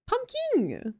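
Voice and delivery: Pumpkin with a high, ringing second syllable; pronounced as spelled. Shown as a demonstration. Streamer defending a chatter's name, spelled-out pronunciation. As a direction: push the end of Pumpkin way up, like king. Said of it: "pronouncing it the way it's spelled".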